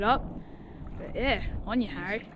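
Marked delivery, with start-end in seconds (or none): none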